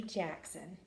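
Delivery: plain reading voice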